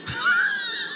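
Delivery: a sudden squealing burst of laughter; high shrieking laugh. Real, not acted. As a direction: let out a high delighted shriek. (ऊँची चीखती हँसी)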